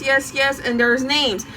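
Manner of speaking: usual speaking voice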